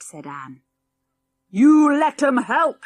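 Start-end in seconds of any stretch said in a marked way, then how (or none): 1.5-2.8 put-on loud, slow, gruff old man shout. Old great-grandad shouting from his corner, loud and slow. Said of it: "shouted the old great-grandad suddenly".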